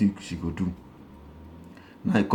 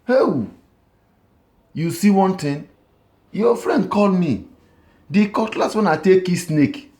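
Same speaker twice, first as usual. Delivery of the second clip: high, eager excuse; husband with an excuse; 8 semitones higher than usual. Retold or imitated. Husband to wife, making up an excuse, voice high and eager. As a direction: raise the voice high, selling the lie. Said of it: "the man come tell the honey".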